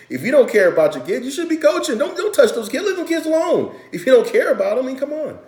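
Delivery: fired-up rant. Real, not acted. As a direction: rant passionately at bad coaches, voice cracking up high. Passionate rant about coaches.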